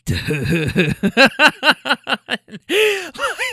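high, rising imitation of a passenger's laugh; imitated laugh. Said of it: "he started laughing like".

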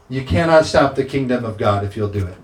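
ordinary delivery

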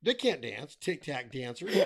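normal talking voice

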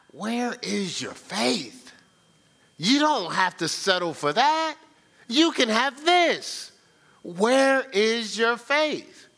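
drawn-out, high-pitched pleading challenge; Christ. Acted. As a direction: stretch each word of the reproachful question. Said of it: "you know what Christ is saying".

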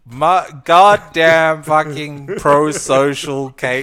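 loud, slow mock complaint about a friend; mock grumbling. Joking to cohost about friend Kate, mock loud grumble. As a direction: grumble it big and slow, all in jest.

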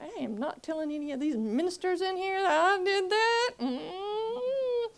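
high, crying refusal; tearful refusal. Retold or imitated. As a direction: whimper it out, near tears.

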